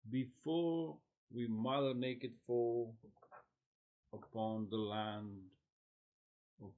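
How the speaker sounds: slow, projected declamation with pauses between phrases; poetry read out slow and strong; poem recitation. Acted.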